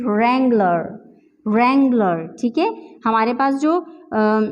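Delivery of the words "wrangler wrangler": wrangler as "RANG-ler", no w sound; silent w in wrangler. Shown as a demonstration. Teacher showing correct wrangler. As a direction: wrangler starting on r. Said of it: "we can pronounce it like this".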